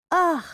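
high-pitched "ugh" of disgust; disgusted groan. Acted. Jess grimacing at her mum and aunt, disgusted groan. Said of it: "Jess grimaced".

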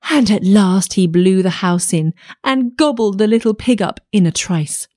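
ordinary delivery

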